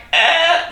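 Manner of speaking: a quick hammed-up effort grunt; straining grunt. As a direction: grunt like the weight is too heavy. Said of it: "that sort of means it's too heavy for you".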